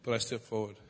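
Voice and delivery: normal talking voice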